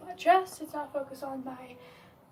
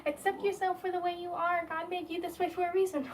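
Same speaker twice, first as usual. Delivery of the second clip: lightly mocking sing-song imitation of well-meaning people, raised pitch; mock preachy advice; 5 semitones higher than usual. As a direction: parrot the pious advice a bit higher, mildly mocking. Quoting people who lecture him.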